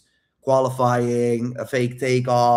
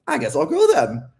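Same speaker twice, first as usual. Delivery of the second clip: high, playful fake sulk; mock takeoff; 12 semitones higher than usual. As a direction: tease her with a fake exit. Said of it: "You're like".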